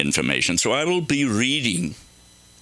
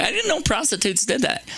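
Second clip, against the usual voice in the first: ordinary delivery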